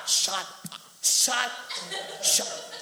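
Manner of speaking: loud, high, cut-off commands; mock-angry shouted interruption; imitated silencing. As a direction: cut them off sharply, mimicking. Acts out wife silencing him.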